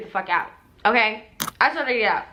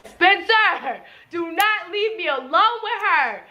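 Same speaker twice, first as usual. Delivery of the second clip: urgent higher call to Spencer; 6 semitones higher, slower than usual. Retold or imitated. To friend Spencer, urgent higher plea. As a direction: beg Spencer not to leave her alone.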